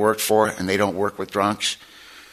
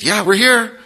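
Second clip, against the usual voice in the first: high, loud, too-bright reassurance; faking it; 11 semitones higher, 4 dB louder, slower than usual. Retold or imitated. Father hiding panic from his daughter, high and overbright. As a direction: pretend everything is fine.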